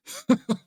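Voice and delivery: short high delighted laugh; bright excited chuckle; burst of laughter. Real, not acted. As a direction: laugh out loud at the cool shot. Excited about a movie shot.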